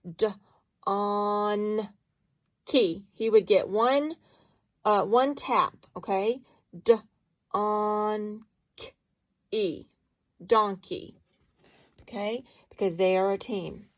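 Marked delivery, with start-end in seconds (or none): 0.0-1.9 "d" short and alone, then "on" drawn out; tapped out sounds. Shown as a demonstration. Teacher taps out donkey for pupils, segmenting "d, on". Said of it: "if you were to tap this". 6.8-9.9 "D, on, k, e" one sound at a time with pauses; tapped out sounds. Shown as a demonstration. Teacher taps each sound of donkey, segmented "D, on, k, e". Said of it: "if you were to tap this".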